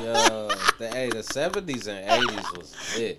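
said through real laughter; laughing. Real, not acted. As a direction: talk while still cracking up. Laughing at the revelation.

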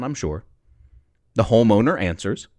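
usual speaking voice

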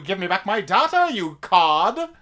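usual speaking voice

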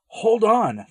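high, loud urgent shout; shouted command. Acted. Stranger shouting to Bosco as the snake thrashes: loud urgent cry. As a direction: yell it so he keeps hold. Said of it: "the stranger shouted".

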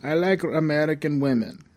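mock Russian comedian accent. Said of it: "he's talked in this R-, Russian accent".

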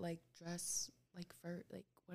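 whispering. Real, not acted. Drops into whisper.

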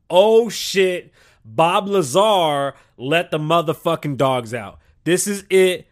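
loud, high alarmed outburst; panicked yell. Retold or imitated. Vlogger yelling in panic at the sky. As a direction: shout it like the sky is breaking.

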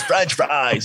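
lightly voiced slow comic's drawl; a hint of John Roy's way of talking; John Roy impression. Telling the host how John Roy talks, light impression. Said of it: "John Roy talks like this".

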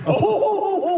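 high, gleeful Santa punchline into a laugh; Brooks. Retold or imitated. Santa springing a foul joke on adults, high jolly voice. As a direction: crow the dirty punchline as Santa. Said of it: "He would just tell foul jokes".

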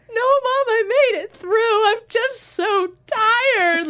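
high, loud, sobbing words; retelling the phone call to mom while sobbing; crying. Retold or imitated. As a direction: cry through exhaustion, slowing at the end. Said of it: "I'm bawling".

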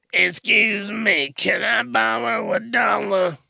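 begging hobo voice. Retold or imitated.

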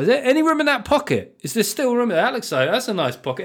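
fawning and hopeful; eager high pleading. Retold or imitated. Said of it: "it's like".

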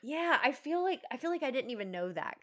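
ordinary talk, nothing marked

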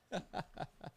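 breathy high giggle. Real, not acted. Laugh after pouring a drink.

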